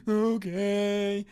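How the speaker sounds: mock tearful sulk. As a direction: imitate a spoiled girl giving in through tears. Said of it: "here she is sobbing".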